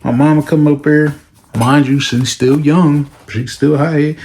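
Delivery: normal talking voice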